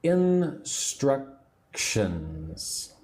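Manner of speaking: "Instructions" drawn right out, in three parts; extremely slow teaching demo of "Instructions"; very slowly. Shown as a demonstration. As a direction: stretch "Instructions" syllable by syllable, in-struc-tions. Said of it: "Can you speak slowly the word".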